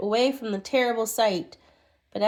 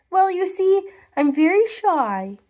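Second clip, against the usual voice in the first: higher, louder, bashful voice; shy little man; 8 semitones higher, 3 dB louder than usual. Acted. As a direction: say it shyly in the little man's voice. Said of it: "said the poor little man".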